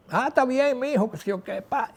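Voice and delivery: a hint of a relaxed dad answering his son; father on the phone. (imitando al papá, con la voz más aguda)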